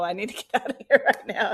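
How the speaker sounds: fast, laughing retold urgency; laughing quote. Real, not acted. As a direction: rush it out with a laugh. Quoting herself wanting to leave.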